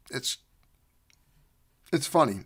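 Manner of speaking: ordinary talk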